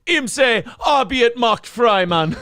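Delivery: slow, high comic Jamaican voice; Jamaican accent. Acted. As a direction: stretch it out in Jamaican. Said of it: "you should be from Jamaica".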